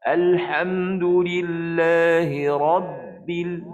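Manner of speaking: slow chanted recitation with a cut-off ba; stretched tajwid chant, voice stopped on the ba; Quranic recitation demo. Shown as a demonstration. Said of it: "contohnya pada Rabb".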